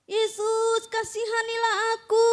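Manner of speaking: beggar's cry. Acted. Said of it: "Apa teriakannya itu?".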